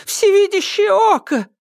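very high, loud terrified cry; panicked storybook squeal; horrified. A narrator's reading. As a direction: exclaim it in dramatic horror. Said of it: "зашлась в ужасе Кикимора".

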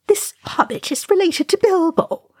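very high, emphatic comeback; retort. A narrator's reading. Oin answering Ori about the hobbit, pointed retort. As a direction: fire it back in a high character voice. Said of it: "retorted Oin".

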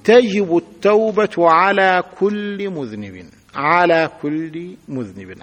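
slow classroom dictation; slow example sentence. Shown as a demonstration. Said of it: "على حرف جر".

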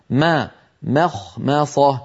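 مخمصة spelled out slowly, both ميم thin, خاء and صاد full; تقطيع مخمصة ببطء مع ترقيق الميمين; very slow syllable drill. Shown as a demonstration. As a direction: drill مخمصة sound by sound, keep the mīms light. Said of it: "القارئ ينطق ميم مرققة".

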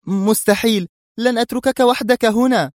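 high, sharp refusal; indignant protest. A narrator's reading. Mona refusing to leave her brother, indignant. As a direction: reject the idea outright. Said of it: "هتفت منى في استنكار".

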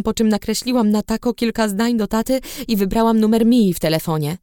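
usual speaking voice

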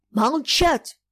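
stern command. Acted. Old master silencing the captain, stern. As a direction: cut him off sternly. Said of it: "сурово сказал Маякин".

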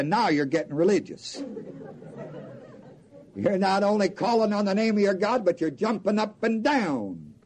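ordinary talk, nothing marked

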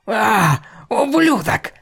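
very high, loud furious yell. Acted. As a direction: scream the insult in rage. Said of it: "орала она".

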